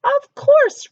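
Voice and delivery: high, loud reply; cheerful princess. Acted. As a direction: chirp reassuringly. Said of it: "replied Princess Peapod".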